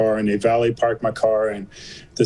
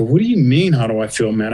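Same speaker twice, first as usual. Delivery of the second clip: indignant retort. Retold or imitated. Patient in pain answers the staff's question, indignant. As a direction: throw the question back at them. Retells snapping at medical staff.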